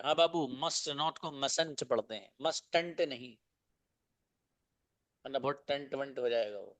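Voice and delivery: ordinary delivery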